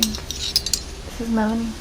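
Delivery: normal talking voice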